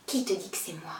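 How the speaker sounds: whispered, voiceless echo; stunned whisper. Acted. Bernard denying the baby is his, hushed whisper.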